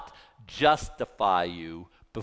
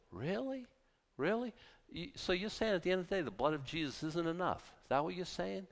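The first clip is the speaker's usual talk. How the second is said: quiet, softer incredulous challenge; incredulous hush; 4 semitones higher, 9 dB quieter than usual. Real, not acted. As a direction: drop the voice and press in disbelief.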